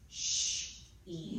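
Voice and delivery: "Sh" as a long hush, then "e" alone; separate sounds. Shown as a demonstration. Teacher does the sounds in she, "Sh" then "e" separated. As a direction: split "Sh" and "e" into two held sounds. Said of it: "Let's do the sounds in she".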